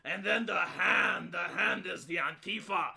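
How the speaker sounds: slow, portentous mock speech; mock Jeremy. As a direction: ham up the grave glove metaphor, slowly. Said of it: "you have Jeremy, and it's like".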